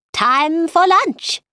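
slow, high announcement. A narrator's reading. Mad Hatter ending his tale of Time, proclaiming. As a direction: announce it grandly, drawn out and high.

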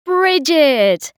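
loud, high jolly greeting; hearty host. A narrator's reading. Host greeting a late guest, loud and jolly. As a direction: boom it out like a jovial uncle. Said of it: "said Geoffrey Elcombrey".

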